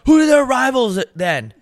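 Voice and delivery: high, loud mock British grilling; imitating a pushy British fan; pressing British accent. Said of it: "he was pressing me the whole time".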